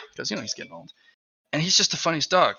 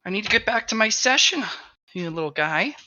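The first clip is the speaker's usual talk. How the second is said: dog voice; 10 semitones higher than usual. Retold or imitated.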